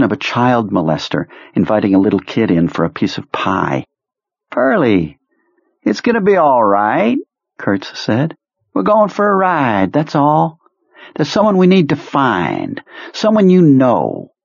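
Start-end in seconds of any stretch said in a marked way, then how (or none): 4.5-7.2 put-on much higher, theatrical old commander; high eccentric officer voice. Kurtz voice. 8.7-14.3 put-on much higher, theatrical old commander; high eccentric officer voice. Kurtz voice.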